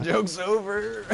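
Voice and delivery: slow, high, loud pretend strain; mock distressed plea. Player ending the stuck bit, high mock-distressed voice.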